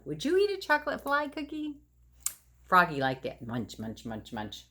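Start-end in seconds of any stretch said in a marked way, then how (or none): 0.0-1.8 playful question. Real, not acted. Asks the children directly. 3.5-4.6 soft munching. Acted. Sound of eating.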